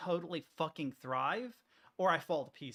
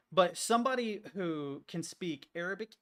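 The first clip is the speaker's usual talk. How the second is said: normal talking voice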